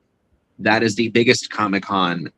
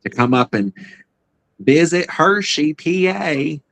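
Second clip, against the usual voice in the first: hammed-up Southern accent, high; mock Southern drawl on Hershey PA; 8 semitones higher, 4 dB louder than usual. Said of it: "terrible Southern accent".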